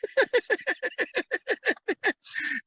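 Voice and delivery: long high-pitched giggling fit; helpless laughter. Real, not acted. After retelling a shocking kid movie scene, helpless laughing.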